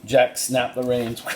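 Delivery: ordinary delivery, nothing marked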